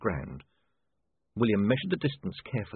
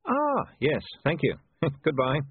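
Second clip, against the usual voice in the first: nervous; 6 semitones higher than usual. Acted. William nervously escaping gnoll.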